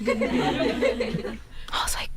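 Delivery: slow whispered giggle. Real, not acted. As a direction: giggle it under your breath. Whispering the story on.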